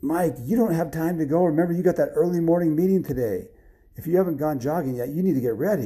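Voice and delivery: a hint of an urging wife; wife reminding. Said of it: "his wife said".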